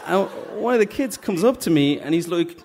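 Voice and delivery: usual speaking voice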